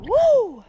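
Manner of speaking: a big celebratory yell, very high; high loud whoop. Real, not acted. Angler celebrating redfish number two, high loud whoop. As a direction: let out a victory whoop.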